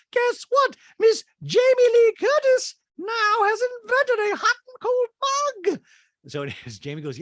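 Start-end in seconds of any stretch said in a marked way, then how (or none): 0.1-5.8 Dorit voice. Retold or imitated. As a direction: gush it in a high, loud mock-posh voice. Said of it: "she's now like".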